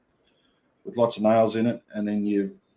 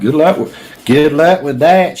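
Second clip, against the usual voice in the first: higher, louder mock echo; mimicking her remark; 4 semitones higher, 12 dB louder than usual. Streamer echoing a woman's good-luck jibe, loud mimicry. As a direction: throw it back louder, drawn out and higher. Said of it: "she said".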